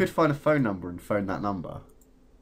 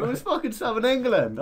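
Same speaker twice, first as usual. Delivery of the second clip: outraged; 11 semitones higher than usual. Real, not acted. Outraged reveal.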